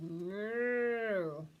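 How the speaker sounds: loud lowing moo; cow moo. Acted. Storyteller mooing as the cow for children. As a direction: moo it out long. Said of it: "So the cow said".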